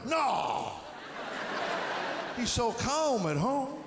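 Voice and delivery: sarcastic high "No!" then slow fake innocence; mock surprise. Dad faking surprise at the teacher's call, high and slow. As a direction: feign shock, then drag it out innocently.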